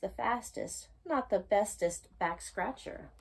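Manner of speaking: usual speaking voice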